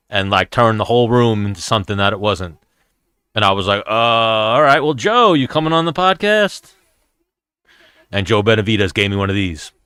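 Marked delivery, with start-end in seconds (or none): 3.8-6.7 strained-casual, higher-pitched self-quote; awkward retold deflection. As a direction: pitch climbing into a hopeful question. Retelling awkward retreat to Joe.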